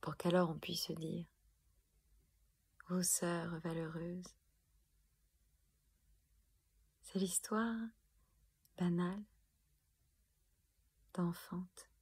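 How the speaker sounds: soft delivery with long pauses; hushed poem ending. Acted. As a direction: let each word land gently, alone. Poem's hushed hopeful closing. (fin de poème douce et recueillie)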